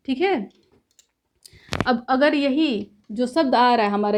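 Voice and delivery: ordinary talk, nothing marked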